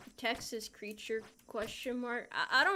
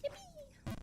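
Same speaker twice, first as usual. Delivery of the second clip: much higher, soft squeaky yippee; cartoon yippee; 9 dB quieter than usual. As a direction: squeak it like a tiny cartoon cheer.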